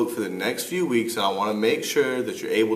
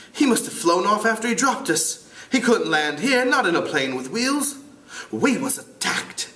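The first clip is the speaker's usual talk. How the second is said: lightly voiced British schoolboy, raised pitch; amateur British accent, a bit higher; 8 semitones higher than usual. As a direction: try a British boy's voice. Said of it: "try to do it with a British accent".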